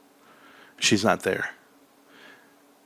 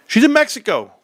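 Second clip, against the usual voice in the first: put-on kid voice; 13 semitones higher, 7 dB louder than usual. Kids telling dad.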